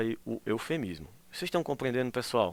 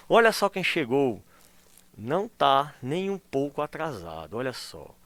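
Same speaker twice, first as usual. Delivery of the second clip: slow sarcastic greeting, louder at the start; 4 dB louder, slower than usual. Shown as a demonstration. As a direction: tease the late classmate slowly. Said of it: "Tá ironizando". (ironia lenta para o colega atrasado)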